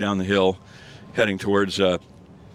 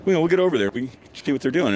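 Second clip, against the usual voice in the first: excited curiosity; 6 semitones higher, faster than usual. Retold or imitated. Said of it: "We're like".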